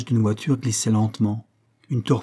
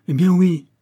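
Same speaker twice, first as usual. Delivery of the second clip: higher, louder blunt reply; defiant retort; 8 semitones higher, 7 dB louder than usual. A narrator's reading. Gorju answers his worried lover, defiant retort. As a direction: throw the answer back defiantly.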